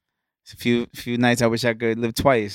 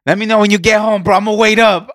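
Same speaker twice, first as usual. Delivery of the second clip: loud; 6 semitones higher, 10 dB louder than usual. Retold or imitated. Drunk friend quoted, loud.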